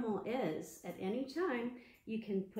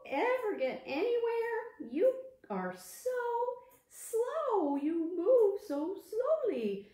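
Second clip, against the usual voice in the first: high, loud taunting voice; mocking hare; 10 semitones higher, 6 dB louder than usual. Hare mocking the tortoise, loud and taunting. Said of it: "laughing and mocking the tortoise".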